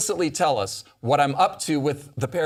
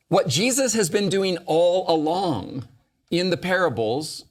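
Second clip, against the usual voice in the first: ordinary talk, nothing marked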